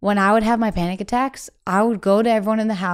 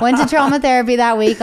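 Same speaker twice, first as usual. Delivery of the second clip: laughing; 5 semitones higher, 6 dB louder than usual. Real, not acted. Laughing while telling punchline.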